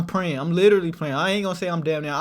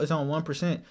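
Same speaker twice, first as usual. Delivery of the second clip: ordinary delivery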